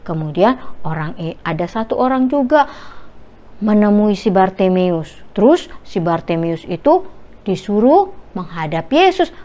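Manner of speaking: usual speaking voice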